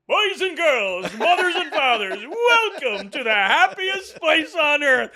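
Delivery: sing-song welcome over laughter; Disney announcer, hammed up through laughter; park announcer. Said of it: "thirty seconds".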